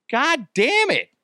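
high, drawn-out exasperated curse; mock outrage. Acted outraged reply to Connery.

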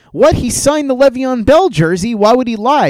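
high, loud, incredulous imitation of Alan; mock indignant friend. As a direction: play the baffled friend defending his jersey. Friend's indignant reply, retold.

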